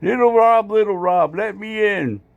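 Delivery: squeaky little dog begging at the door; voicing the dog. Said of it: "She's barking, saying".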